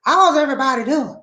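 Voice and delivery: high put-on voice, sung out; hammed-up character greeting. Shown as a demonstration. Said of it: "whose voice what I just did".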